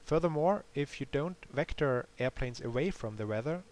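usual speaking voice

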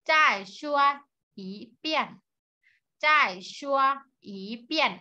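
"จ้ายชัว อี เปี้ยน" syllable by syllable with pauses and clear Mandarin tones; model pronunciation. Shown as a demonstration. Teacher has students repeat after her, "จ้ายชัว อี เปี้ยน" carefully separated by tone. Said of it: "ออกเสียงตามเหล่าซื้อนะคะ".

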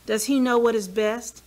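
normal talking voice

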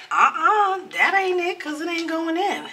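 high, exasperated uh-uh; fed up with the gadget. Real, not acted. Frustrated with the lock.

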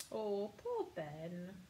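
ordinary delivery

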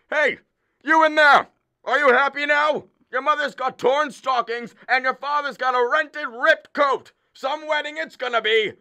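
a father hollering through a bathroom door; yelling through the door. Acted. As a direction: call out bitterly to the daughter inside. Shouting at daughter through door.

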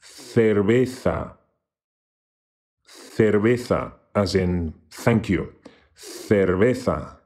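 Cerveza said slowly, with c and z as English th; slow teaching take of Cerveza, th th; slow pronunciation demo. Shown as a demonstration. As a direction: spell it out slowly with the sound. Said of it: "pronounced as English T Hs".

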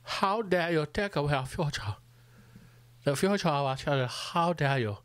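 lightly put-on indignant young activist voice; half-mock an outraged speech; Thunberg impression. Comic mocking a climate activist's speech, lightly voiced and indignant. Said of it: "You'd heard her on going".

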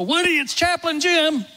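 high, loud hello into the room; cheery loud greeting. Retold or imitated. Chaplain greeting a frail patient with a loud cheery call. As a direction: call out his name brightly to wake him.

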